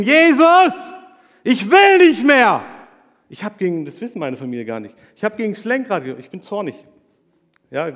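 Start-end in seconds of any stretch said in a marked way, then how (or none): 0.0-2.6 screaming, much higher and louder; retold scream. Man recalling screaming at Jesus in his car, desperate yell. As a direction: cry out in despair at God. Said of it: "Ich habe geschrien".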